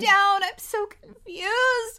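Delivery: high, loud, wailing panic, acted out; breakdown. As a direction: imitate a frantic student falling apart. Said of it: "this is the breakdown moment".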